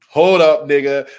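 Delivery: loud, firm stop; punchy mock command; forceful hold up. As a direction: bark it like a tough guy. Deku voiced loudly in retelling.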